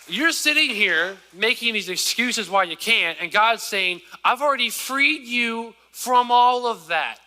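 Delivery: high, loud preacher voicing God; God speaking through the preacher; preaching. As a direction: lift the congregation as God's promise.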